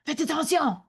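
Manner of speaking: high-pitched French aunt's telling-off; scolding French aunt. Retold or imitated. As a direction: imitate an aunt calling unruly kids to attention. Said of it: "to get my cousins to stop".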